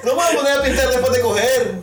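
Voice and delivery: loud laughing disbelief; incredulous protest. Real, not acted. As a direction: protest through laughter, pitch up. Incredulous reply in joking game.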